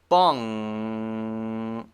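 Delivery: "bong" with a long, steady held ng; long back ng. Shown as a demonstration. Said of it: "back of the mouth".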